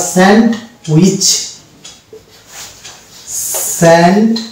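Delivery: স্যান্ডউইচ slowly split as "send... wich"; beginner's sounded-D misreading. Shown as a demonstration. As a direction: sound the D in স্যান্ডউইচ, pause after it. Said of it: "এইটাকে উচ্চারণ করবো আমরা".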